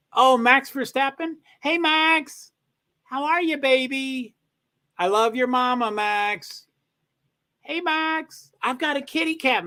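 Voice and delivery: high-pitched cooing to a dog; talking to a beloved pup; pet-talk voice. Real, not acted.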